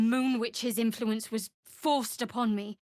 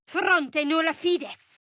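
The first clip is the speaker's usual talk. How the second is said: incantation; 7 semitones higher, slower than usual. Acted. Casting spell in Latin.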